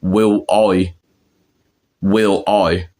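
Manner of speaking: "Will I" said as "wiw oy"; Cockney pronunciation. Shown as a demonstration. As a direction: round the "I" into "oy" and drop the L. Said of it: "they say it like".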